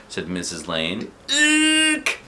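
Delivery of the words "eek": high shrieked eek; eek scream. A narrator's reading. School nurse squealing at the news, high shriek. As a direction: squeal it in a panic. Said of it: "screamed our school nurse".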